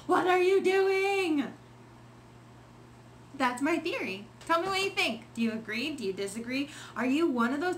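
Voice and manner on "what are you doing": high, drawn-out yell at another driver; exasperated yell. Retold or imitated. Driver yelling at slow cars from behind the steering wheel, exasperated yell. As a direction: yell it slowly, stretching out each word. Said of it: "I'm yelling at them".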